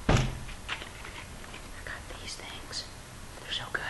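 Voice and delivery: very soft whispered aside. Pretend. Vlogger confiding about some treats, hushed whisper. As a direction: whisper it as a secret.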